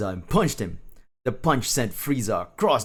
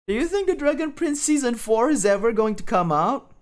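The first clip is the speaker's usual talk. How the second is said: high-pitched wondering question; high, chatty character voice; anime schoolkid; 13 semitones higher, 4 dB louder than usual. Acted. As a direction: ask it in a high cartoon voice. Pending's line in put-on voice.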